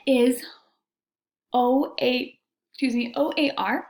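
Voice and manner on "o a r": ordinary delivery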